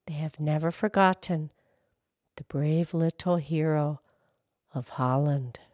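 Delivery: storybook reading voice; story narration. Acted. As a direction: read it like a bedtime story. Reads a children's story aloud.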